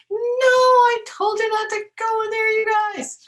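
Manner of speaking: high, loud exasperated scolding; exasperated cry. Pretend. Reader crying out at the birds for disobeying. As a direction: scold the birds for not listening.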